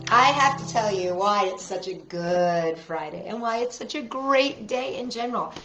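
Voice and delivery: ordinary delivery, nothing marked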